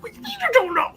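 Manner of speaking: very high, squeaky disbelief; incredulous squeak. Acted. Aiden reacting to the agent not knowing, squeaky disbelief. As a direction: squeal it through amusement. Reacting to answer amid laughter.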